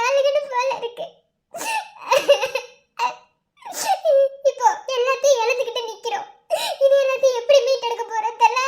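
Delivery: anguished, sob-broken speech; crying. Acted. Sleepless wife burdened by doubts about a child, crying. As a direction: let the sobs break up each phrase. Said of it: "நீ அழுதுகிட்டு இருக்க".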